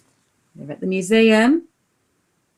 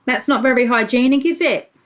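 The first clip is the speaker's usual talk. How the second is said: plain reading voice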